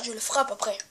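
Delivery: ordinary talk, nothing marked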